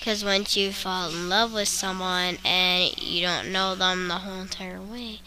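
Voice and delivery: ordinary talk, nothing marked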